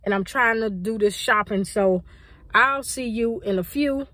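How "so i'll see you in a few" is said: ordinary delivery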